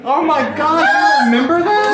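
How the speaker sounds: high-pitched, excited shout with laughter; real laughing outburst of recognition; delighted disbelief. Real, not acted. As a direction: squeal it, thrilled and laughing. Delighted at shared memory of film.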